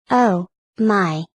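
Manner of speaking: ordinary delivery, nothing marked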